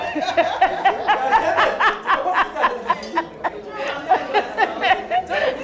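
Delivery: a long, high, rolling laugh. Real, not acted. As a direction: laugh through the memory. Laughs retelling husband story.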